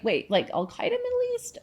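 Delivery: high-pitched incredulous question; baffled disbelief. Retold or imitated. American comic replaying her reaction to a Dubai gig, incredulous. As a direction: squeak it out in mock alarm. Acting out baffled reply to friend.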